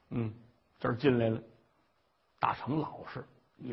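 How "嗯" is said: ordinary talk, nothing marked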